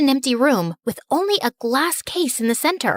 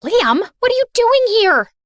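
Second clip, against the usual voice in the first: startled outburst; 7 semitones higher, 4 dB louder than usual. Acted. Startled awake, punched shadow.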